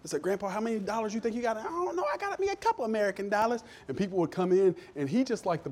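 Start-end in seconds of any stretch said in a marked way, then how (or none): 0.2-1.5 higher, quick question in a retold exchange; lightly acted curious grandson; grandson asking grandpa. Grandson to grandpa about his cash, higher questioning voice. 1.5-3.6 very high put-on country accent; grandpa's country accent. Grandpa answering about his dollars, put-on country accent. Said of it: "he had this country accent".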